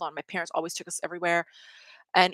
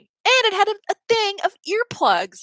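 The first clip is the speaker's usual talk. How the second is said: excited squeal; 12 semitones higher, 6 dB louder than usual. Real, not acted. Delight over the free earplugs.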